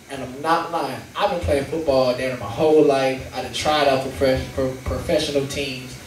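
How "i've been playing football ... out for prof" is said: normal talking voice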